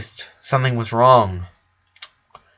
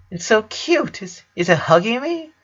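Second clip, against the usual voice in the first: high voice; 10 semitones higher than usual. A narrator's reading. Brother worried about snake.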